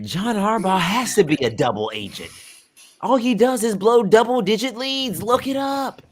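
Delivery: mock Bam exposing a double agent; Bam impression. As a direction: sell the wild theory with total certainty. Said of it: "That's like Bam".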